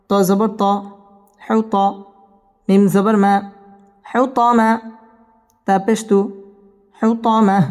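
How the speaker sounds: ordinary talk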